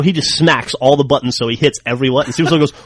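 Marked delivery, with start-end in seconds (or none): none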